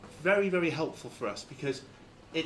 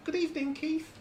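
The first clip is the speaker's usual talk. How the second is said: parrot; 3 semitones higher than usual. Acted. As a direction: greet them as a chirpy talking parrot, pitched way up. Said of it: "the parrot turns around and says".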